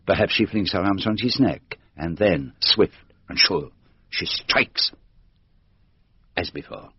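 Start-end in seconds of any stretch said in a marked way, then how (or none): 4.1-6.9 whispered, hushed reveal of the blow; dramatic whisper. Acted. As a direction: breathe it out like a secret. Poirot dramatizes the killing blow.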